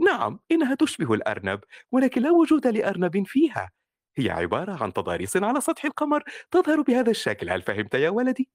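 high, gentle mother explaining kindly; reassuring mother voice. Shown as a demonstration. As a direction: soothe and explain like a loving mom. Mother explains to her son.

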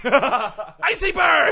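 slow, laughing shouted catchphrase; French accent. Acted. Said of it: "the Icy Burn knows no boundaries".

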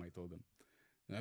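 ordinary talk, nothing marked

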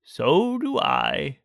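unhurried, affectionate storybook bear; contented Pooh. A narrator's reading. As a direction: draw it out fondly. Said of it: "said Pooh".

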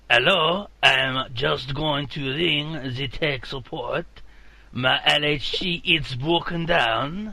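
slow, exaggerated French accent. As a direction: play a hammed-up Frenchman on the phone. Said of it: "the Frenchman".

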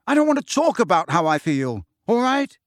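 heated outburst climbing high; raging. A narrator's reading. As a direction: shut him down angrily. Harry shaking with rage snaps.

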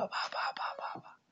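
rapid whispered beats; whispered counting. Acted. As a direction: tick them off in a whisper. Mitzvot coming one after another.

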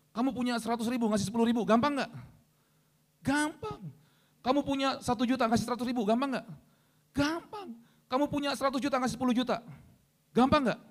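high-pitched, climbing quiz questions; escalating challenge. Pretend. Preacher quizzes congregation on giving a tenth, high escalating challenge.

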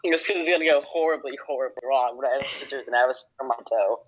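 normal talking voice

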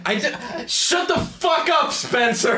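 high, drawn-out yell to shut him up; exasperated shout. Real, not acted. Player telling a teasing friend off, exasperated shout.

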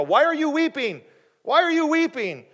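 loud, high-pitched, uncomprehending demand; exasperated husband. Acted. Clueless husband questioning his weeping wife, exasperated. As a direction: pitch it way up, baffled and impatient. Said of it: "It was more like a".

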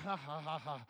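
quiet "ha ha ha" laugh; a low, quiet chuckle to himself; soft chuckle. Real, not acted. Preacher laughing at congregation.

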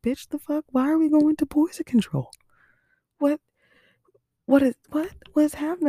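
high, loud incredulous outburst; shocked disbelief. Retold or imitated. To the 811 nurse, shocked at poison control, high disbelieving outburst. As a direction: blurt it out, stunned and indignant.

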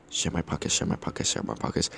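whispered hidden message; subliminal whisper. Shown as a demonstration. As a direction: breathe it in their ear like a hypnotist. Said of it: "plant subliminal message in your head".

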